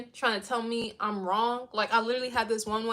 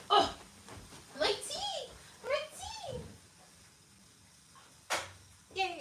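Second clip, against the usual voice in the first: high squeal of delight over her tea; light, high "my tea!" as she spots it; excited yelp; 13 semitones higher, 8 dB quieter, slower than usual. Real, not acted. Notices her tea is ready.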